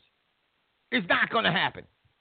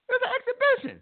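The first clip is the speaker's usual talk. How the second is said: higher, laughing repeat; 14 semitones higher, faster than usual. Real, not acted. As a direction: insist through laughter.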